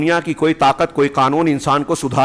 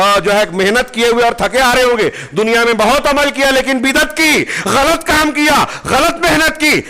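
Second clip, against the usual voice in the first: impassioned preaching; 7 semitones higher than usual. Acted. Preacher warning of wasted toil on Judgement Day, impassioned. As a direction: build to a stretched, high-pitched condemnation, then ease.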